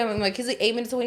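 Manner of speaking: ordinary talk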